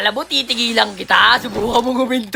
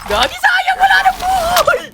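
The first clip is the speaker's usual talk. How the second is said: excited yelp. Real, not acted. Gamer yelping at a missed kill, high and excited. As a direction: yelp as the kill slips away.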